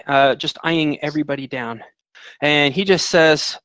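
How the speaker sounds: ordinary delivery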